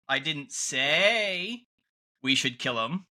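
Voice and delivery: "say" stretched long and rising; slow, leaning hard on "say"; stress on SAY. Shown as a demonstration. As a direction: draw out "say", pause before the rest. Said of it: "emphasize a different word in the sentence".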